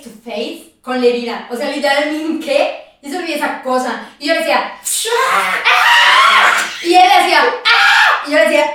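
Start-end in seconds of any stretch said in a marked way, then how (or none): 4.8-6.7 very high, loud shriek; reenacted panic scream. Reenacts both screaming at the wound. 7.4-8.2 high, loud mock scream; imitated answering scream.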